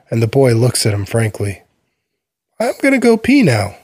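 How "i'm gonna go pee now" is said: put-on very high, plain child's announcement; matter-of-fact little boy. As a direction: say it like a small boy stating a fact.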